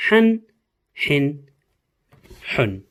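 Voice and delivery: Han with a sharp throaty H; teacher demonstrating the sharp Ha sound; Arabic letter Ha drill. Shown as a demonstration. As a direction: push the H from the throat in Han, Hin, Hun. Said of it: "one more last time".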